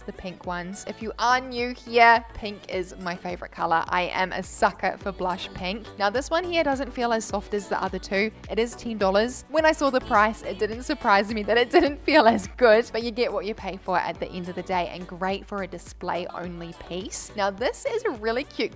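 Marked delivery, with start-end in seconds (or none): none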